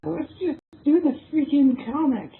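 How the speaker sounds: high, put-on exasperated voice. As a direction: throw up your hands. Exasperated redirect.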